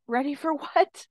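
high, loud, incredulous question; startled retort. Retold or imitated. Retold startled reply to the voice.

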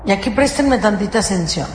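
ordinary talk, nothing marked

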